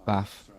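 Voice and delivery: "bath" with a short a as in cat, ending like baf; short northern a in "bath"; short-vowel bath. Shown as a demonstration. Yorkshire lad repeating his own version, "bath" with short a. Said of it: "I say".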